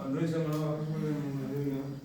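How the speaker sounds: perfunctory, monotone mumbling; half-hearted proclamation. Acted. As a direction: preach it without any effort. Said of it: "성의 없이 막 전하는 거죠". (성의 없이 웅얼웅얼 전하듯)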